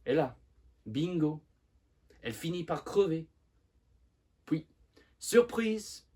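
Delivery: loud, high, exasperated outburst; furious frustration. Acted. Simone rails at his mother's last surprise, loud and furious. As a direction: punch out each bitter surprise, building to a shout. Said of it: "exprime sa rage et sa frustration".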